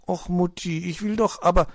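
put-on high-pitched child's protest to mum. A narrator's reading. Young hedgehog to his mother, protesting. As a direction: object like a little hedgehog overruled. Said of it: "protestierte Borstel".

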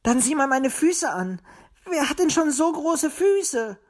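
high, aggrieved whine of protest; complaining. A narrator's reading. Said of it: "beschwerte sich Willi". (hoch und beleidigt klagend)

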